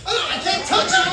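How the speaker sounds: high-pitched frantic protest; hammed-up alarm; helpless panic. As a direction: squeal it in mock distress. Acted helpless panic under falling lamp.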